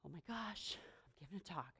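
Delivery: hushed, breathless whisper of nerves; nervous whispered self-talk; whispered panic. Retold or imitated. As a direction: whisper it like you're scared to be heard. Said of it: "Literally the whole day I was like".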